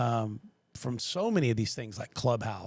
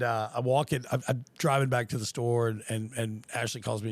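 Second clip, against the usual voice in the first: ordinary talk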